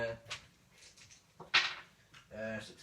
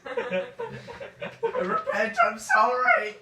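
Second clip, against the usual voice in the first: giggly high squeal of panic; laughing mock pleading; 15 dB louder, much slower than usual. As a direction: beg through your own laughter. Players mock burning cultists.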